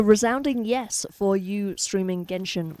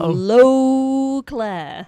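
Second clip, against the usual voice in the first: Sponicus Rex impression; 3 semitones higher, 5 dB louder, much slower than usual. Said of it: "his best Spornicus Rex impression".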